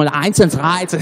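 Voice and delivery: mock heavy German accent. Shown as a demonstration. Showing how Germans must be spoken to, heavy German accent. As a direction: overdo the German consonants. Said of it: "לדבר את המבטא הכבד".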